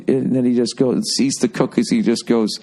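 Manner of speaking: ordinary talk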